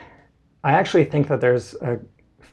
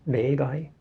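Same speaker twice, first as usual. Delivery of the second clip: ordinary talk, nothing marked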